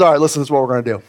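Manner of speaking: fast, offhand God voice; casually voiced God; voicing God. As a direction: rattle it off quick as God laying out the plan. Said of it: "And God says".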